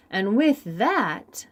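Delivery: ordinary delivery, nothing marked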